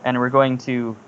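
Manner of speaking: usual speaking voice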